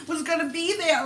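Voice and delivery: real tears, voice breaking while telling; talking through sobs; choked up, recalling the new job; crying. Real, not acted. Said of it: "I was so sad".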